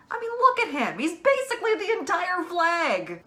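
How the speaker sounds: high, loud, emphatic exclaiming; excited insistence. Real, not acted. Fan arguing a character is non-binary, excited insistence. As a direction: burst out high as if it were obvious.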